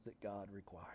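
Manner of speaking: hushed whispered close of the verse; voiceless whisper ending the quote. Real, not acted. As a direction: drop to a breathy whisper. Closing scripture quote in whisper.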